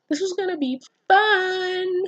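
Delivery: high, slow gleeful line; high, slow, relishing excitement; gleeful read. Acted. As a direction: savor the line like a delighted psycho.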